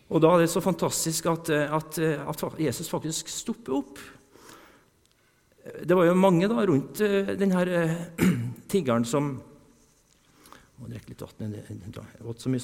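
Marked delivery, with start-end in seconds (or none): none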